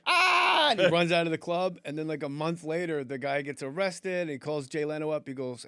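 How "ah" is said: loud, high pained scream; stabbed scream. Retold or imitated. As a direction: yell as if just stabbed. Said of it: "the guy's like".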